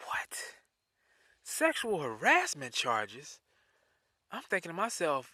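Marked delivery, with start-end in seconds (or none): 0.1-0.6 whispered in disbelief; stunned whisper. Retold or imitated. Stunned at the accusation. 1.4-3.4 slow disbelief. Retold or imitated. Repeating the charge in disbelief.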